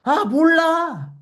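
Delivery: put-on high, slow, whiny sulk of a teen girl; mock teenage girl whining 아, 몰라; sulky teen girl. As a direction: brush it off like a sulky adolescent. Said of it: "사춘기 소녀처럼 얘기하는".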